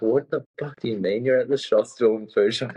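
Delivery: mock-baffled questioning; retold disbelief. Retells friends' disbelief on the phone.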